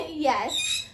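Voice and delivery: ordinary talk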